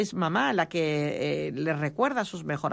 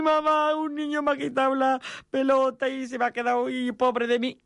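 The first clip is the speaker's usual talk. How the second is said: boy coming home from school to mom, mock wail; mock crying child; 7 semitones higher than usual. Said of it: "Y vuelve a llorar".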